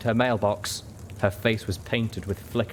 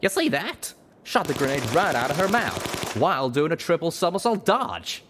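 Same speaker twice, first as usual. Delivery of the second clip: excited, high-pitched bragging; boastful pegasus; 8 semitones higher than usual. A narrator's reading. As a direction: crow about your own trick shot. Pegasus boasts about his shot.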